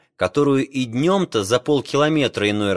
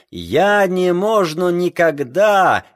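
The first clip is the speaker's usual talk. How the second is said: broken Russian; 7 semitones higher, 4 dB louder, slower than usual. Acted. Spaniard protests in broken Russian.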